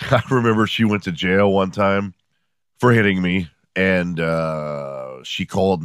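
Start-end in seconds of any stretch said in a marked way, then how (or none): none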